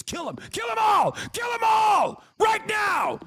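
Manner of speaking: high screaming kill 'em all; mock bloodthirsty shout. Streamer parodying a hawk crying for a crackdown, high mock shout.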